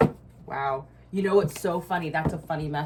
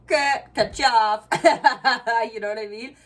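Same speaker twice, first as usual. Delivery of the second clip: laughing; 6 semitones higher, 9 dB louder than usual. Real, not acted. Laughing boast, cutting people off.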